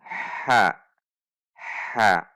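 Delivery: short "ha" made from the middle of the throat; correct throaty ha. Shown as a demonstration. Teacher drills the correct letter, mid-throat ha. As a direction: say "ha" crisply from mid-throat, then repeat it.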